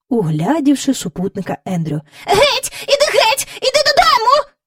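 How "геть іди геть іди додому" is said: high, loud shooing; shouting away. A narrator's reading. Miss Lark shouts at the stray dog to go away, high and loud. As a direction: chase the dog off. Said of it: "закричала вона".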